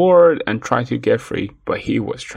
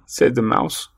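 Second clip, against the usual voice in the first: usual speaking voice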